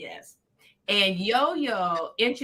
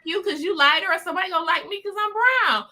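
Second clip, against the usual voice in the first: higher, breezy, quick reassurance; confident friend; 9 semitones higher, faster than usual. Retold or imitated. Friend reassures her they only need five dollars, breezy and confident. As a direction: brush off the worry with easy confidence. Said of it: "She was like".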